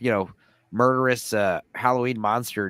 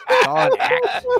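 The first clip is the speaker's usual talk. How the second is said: laughing shout; 5 dB louder than usual. Real, not acted.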